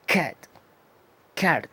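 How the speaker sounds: "cat" with a dry, hard K; crisp dry K at the start of "cat". Shown as a demonstration. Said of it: "una K seco".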